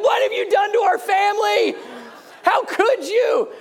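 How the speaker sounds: high, loud hammed-up dismay; mock outraged shout. Wife in mock horror at the sons asking for washcloths, shouting high. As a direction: cry out in mock horror at the family. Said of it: "And Becky's like".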